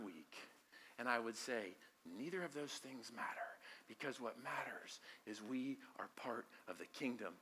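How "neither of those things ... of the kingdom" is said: soft unvoiced whisper of the point; hushed whisper. Preacher whispers to the church, hushed. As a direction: breathe it out like a secret.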